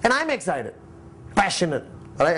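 ordinary talk

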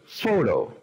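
Photo in English, stressed on "Pho"; Photo, strong first syllable, falling tune. Shown as a demonstration. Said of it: "es la que tiene el acento".

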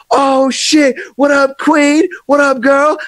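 very high, loud excited hail; excited greeting. Retold or imitated. Friends greeting [name], very high loud hail. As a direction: hail her like old friends.

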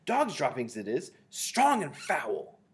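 high, loud confirmation; crying out. A narrator's reading. Another witch confirming the smell, cried out. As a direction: declare it high, drawing out the last words. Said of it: "cried another voice".

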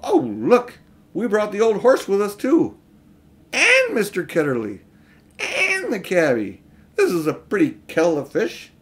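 Polly seeing everyone came along; cried out. A narrator's reading. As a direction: call it out in surprise. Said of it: "Polly cried out".

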